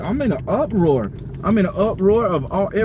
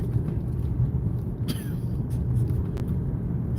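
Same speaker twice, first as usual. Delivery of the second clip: breathy laugh; 7 dB quieter than usual. Real, not acted. Bitter laugh after rant.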